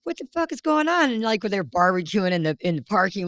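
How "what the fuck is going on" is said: baffled. Retold or imitated. Retold baffled quote, much higher.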